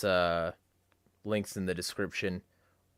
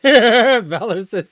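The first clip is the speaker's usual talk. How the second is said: slow, high laugh-filled reading; laughing out the name; cracking up at a chat message; 12 semitones higher, 16 dB louder, much slower than usual. Real, not acted.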